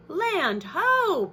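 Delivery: high, loud "Land ho!" call; cried out. Acted. Pete calling from the crow's nest on spotting land, loud and high. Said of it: "Pete cried".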